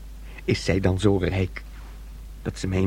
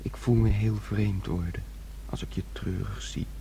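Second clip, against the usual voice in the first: tender whisper; 3 semitones lower, 9 dB quieter than usual. Acted. Son tenderly comforting his sister.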